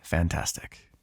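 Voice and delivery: sigh, then a low, quiet sarcastic word; deflated, sarcastic murmur after a heavy breath; bitter resignation. Acted. House has been gutted.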